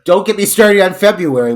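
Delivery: high, loud giddy teasing; excited. Real, not acted. Host hyping the Valentine calendar, loud giddy. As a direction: gush about it with a laugh.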